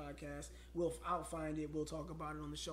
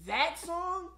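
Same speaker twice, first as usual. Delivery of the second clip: loud outburst; 12 semitones higher, 8 dB louder than usual. Real, not acted. Dreaded breakup song named.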